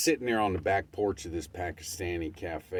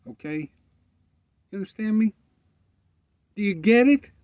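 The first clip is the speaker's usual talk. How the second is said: much higher, louder demand; emphatic challenge; 9 dB louder, faster than usual. Real, not acted. Pressing the listeners hard, loud high challenge. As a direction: demand they get it.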